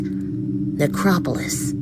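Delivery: slow eerie whisper. Acted. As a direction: breathe the word like a secret. Eerie inscription read.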